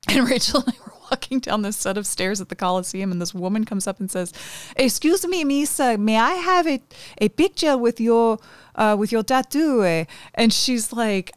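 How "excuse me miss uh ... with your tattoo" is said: high-pitched, hammed-up generic European accent; mock Italian accent. Imitating a tourist asking for a tattoo picture, mock Italian accent. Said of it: "awful stereotypical Italian accent that you did".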